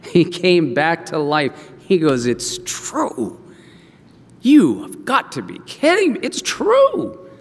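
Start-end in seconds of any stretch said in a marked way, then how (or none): 2.3-3.4 low, soft murmur of belief; dawning realization. Acted. Jacob coming back to life, hushed realization. Jacob reviving, takes in news. 4.4-7.3 high-pitched amazed outburst; astonished joy. Acted. Jacob learning his son lives, astonished high exclamation.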